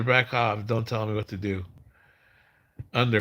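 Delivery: normal talking voice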